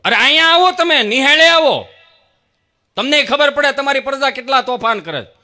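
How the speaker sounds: loud, high, scolding complaint; angry teacher ranting about a naughty boy; complaining teacher. Retold or imitated. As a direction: scold the father down the phone.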